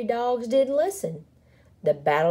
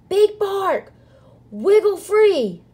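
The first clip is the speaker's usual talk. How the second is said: high, loud urgent shout; 12 semitones higher, 7 dB louder than usual. A narrator's reading. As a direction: shout up to someone far above. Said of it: "the prairie dog shouted".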